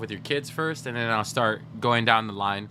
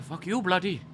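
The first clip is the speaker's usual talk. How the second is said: higher-pitched mock Indian accent; imitated Indian accent; 7 semitones higher than usual. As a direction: mimic an arguing man's Indian accent. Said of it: "He was imitating his accent".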